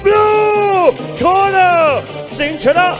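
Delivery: drawn-out high shouted announcement; ring announcer. Real, not acted. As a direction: announce the winner with big build-up. Winner announcement. (ประกาศผู้ชนะแบบลากเสียงตะโกน)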